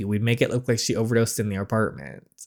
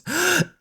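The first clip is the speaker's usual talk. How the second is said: startled gasp. Acted. Shocked at someone in the closet.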